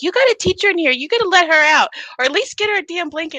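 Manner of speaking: high, loud call over a loudspeaker; officer on loudspeaker. Retold or imitated. Cellmate yelling for the guard to help, loud. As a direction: bark it down the hall at the guards. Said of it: "spamming the loudspeaker".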